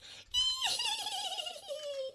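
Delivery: high, wavering little squeal; long fluttery high-pitched giggle-squeal; excited squeal. Acted. Butterfly thrilled with her Easter egg, excited squeal.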